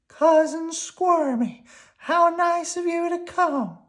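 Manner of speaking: high squeaky worm; high, cheerful little worm. Acted. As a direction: pitch it up like a tiny worm. Slimy voiced.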